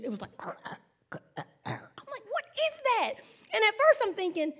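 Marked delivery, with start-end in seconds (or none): 0.4-1.9 quiet, unvoiced throaty hacking; demonstrated dog choking. Shown as a demonstration. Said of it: "if I give y'all the demonstration". 2.3-3.1 high-pitched mock bewilderment; baffled reaction. Retold puzzled reaction to strange noise.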